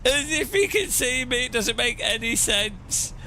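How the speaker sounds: high-pitched words squeezed out through helpless laughter; crying with laughter. Real, not acted. Streamer to chat over a silly replay, crying with laughter. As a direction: laugh so hard the words barely come out. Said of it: "I'm crying at how stupid that is".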